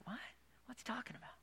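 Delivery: puzzled disciples whispering; hushed bewildered disciples; confused whisper. Pretend. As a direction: mutter it in confusion.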